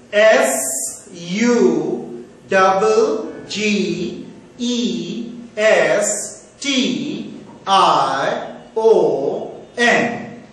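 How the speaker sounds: very slow, letter by letter; spelling out. Shown as a demonstration. Teacher spelling the second word for students, very slowly.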